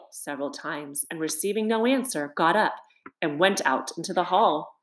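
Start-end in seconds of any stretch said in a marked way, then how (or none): none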